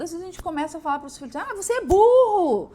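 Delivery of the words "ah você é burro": high, loud scornful yell; mock angry mother yelling; yelling at child. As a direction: scream the insult at your kid.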